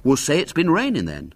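understated encouragement; encouraging. A narrator's reading. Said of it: "said Douglas encouragingly".

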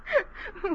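high peal of laughter; giggle, charmed by the beachcomber; amused giggle. Acted. Tourist woman to Clive, amused giggle.

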